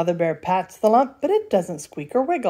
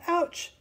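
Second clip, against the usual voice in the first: high ouch; 10 semitones higher, 5 dB quieter than usual. Acted. Little Bear bumps head.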